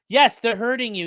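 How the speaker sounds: ordinary talk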